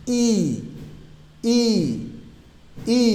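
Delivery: long, drawn-out "ই" with a falling tune; টানা ই. Shown as a demonstration. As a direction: stretch each "ই". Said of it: "একটু টান হবে". ("ই" টেনে টেনে বলা)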